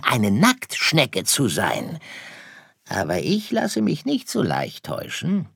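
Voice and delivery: high, swooping fox voice; storybook fox, smug and not fooled; knowing fox. Acted. Fox explains himself. (überlegener Fuchs, singend hohe Stimme)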